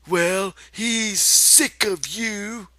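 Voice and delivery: high, slow taunting rabbit. Acted. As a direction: drag out the sneer slowly.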